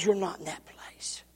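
whispered aside; soft unvoiced whisper. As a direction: hush it to a whisper. Aside to God.

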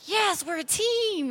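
high, slow, gushing yes; eager agreement. Retold or imitated. Wife to husband, gushing about a united front. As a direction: gush over being a team, stretched out high. Retelling her eager reply.

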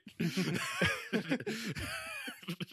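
shrill burst of laughter; high squealing laugh. Real, not acted. After the He's great quote.

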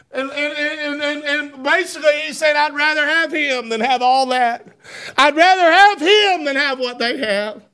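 preacher crying out the promise; preaching at full force. Acted. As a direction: swell it up for the congregation.

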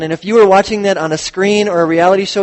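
ordinary delivery

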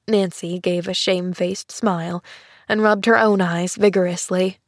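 plain reading voice

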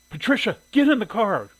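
high, loud maternal command; angry order. A narrator's reading. Mother ordering her daughter out the door, angry. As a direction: shout it as an irate mother. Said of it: "her mother was angry".

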